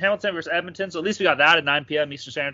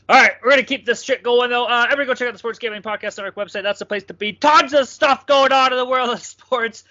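hyped podcast plug; 8 semitones higher, 6 dB louder than usual. Acted. Host hypes the network to listeners, announcer style. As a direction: sell the network like a radio promo.

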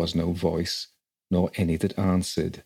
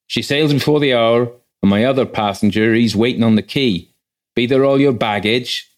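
a loud, hearty boatman, pitched higher; gruff boatman; 4 semitones higher, 8 dB louder than usual. A narrator's reading. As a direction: call out to the travellers like a burly quay boatman. Voices the rough boatman.

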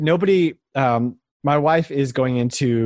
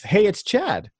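higher, bright announcer-style hello; upbeat intro; 8 semitones higher, slower than usual. Acted. Host introducing a listener question, upbeat announcer hello. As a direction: announce the listener's name with a lift.

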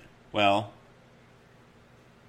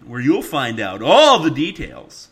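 much higher, much louder, slow booming promo; announcer voice; 7 semitones higher, 12 dB louder, slower than usual. Acted. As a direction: boom it out like a big sports announcer. Promo tag line for show.

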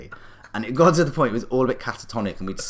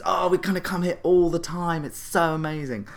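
high-pitched, overexcited tipsy gush; mock gushing; 10 semitones higher, 3 dB louder than usual. Drunk family booking another table as they leave, hammed-up gushing.

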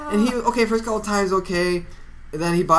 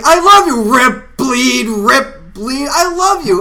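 high, loud forced love over inner pain; sing-song torment of a parent; a parent's high, loud, strained cheer; 7 semitones higher, 7 dB louder, slower than usual. Retold or imitated. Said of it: "They're going".

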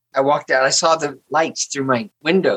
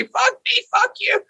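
very high mock-fight retort; retold theatrical yell, pitched way up; loud theater voice. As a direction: fire it back as a staged quarrel. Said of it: "we were really loud, using our theater voices".

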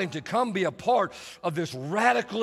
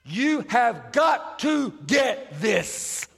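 emphatic; much slower than usual. Acted. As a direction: hammer each word home slowly. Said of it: "emphasizing by saying".

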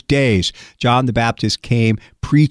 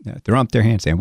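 ordinary delivery

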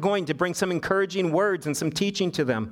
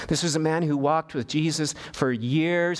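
usual speaking voice

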